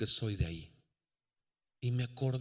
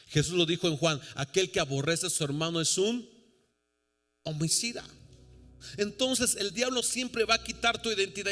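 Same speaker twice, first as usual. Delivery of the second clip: impassioned preaching, building higher and louder; forceful preaching; 5 semitones higher, 4 dB louder than usual. Real, not acted. Preacher warning congregation about the devil's lies, forceful preaching. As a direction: drive it home, climbing in pitch and force.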